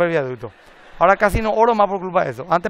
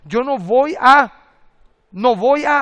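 "no voy a" drawn out slow and loud; slow, determined vow; resolute declaration; 5 semitones higher, 7 dB louder, slower than usual. Shown as a demonstration. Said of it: "Yo tomo una decisión". (declaración lenta y decidida)

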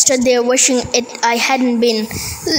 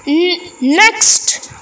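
higher, curt headmaster order; snapped; 5 semitones higher than usual. A narrator's reading. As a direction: snap it at the waiting boys. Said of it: "snapped Mister Coombs".

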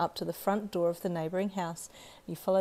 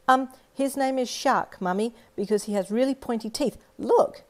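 put-on high, loud eager little girl; eager child; 5 semitones higher, 5 dB louder than usual. Little girl answers for the boy to her mum, eager and high. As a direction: blurt it out excitedly.

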